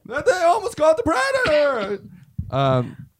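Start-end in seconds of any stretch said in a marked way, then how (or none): 0.0-2.0 high, drawn-out mock stand-up voice; exaggerated joke-delivery voice; hammed-up comedian punchline. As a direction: stretch the punchline out, pitched way up.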